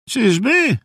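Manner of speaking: high, doubting question; sceptical query. A narrator's reading. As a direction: question it as a suspicious grandfather. Suspicious grandpa doubts him. (wysokie, podejrzliwe pytanie dziadka)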